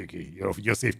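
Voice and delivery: normal talking voice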